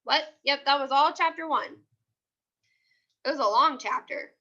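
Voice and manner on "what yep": ordinary delivery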